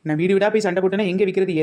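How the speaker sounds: usual speaking voice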